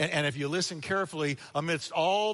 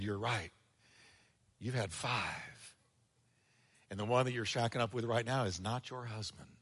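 Jesus, soft and low; 7 semitones lower, 7 dB quieter than usual. Retold or imitated.

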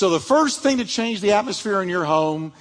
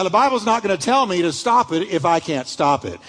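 usual speaking voice

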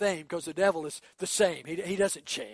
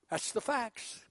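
ordinary talk

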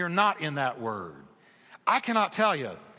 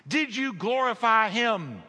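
ordinary talk

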